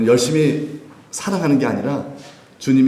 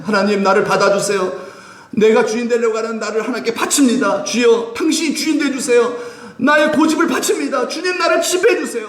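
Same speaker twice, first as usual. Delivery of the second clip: fervent crying prayer; 10 semitones higher than usual. Acted. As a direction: cry out to God, pleading. Acting believers' fervent prayer.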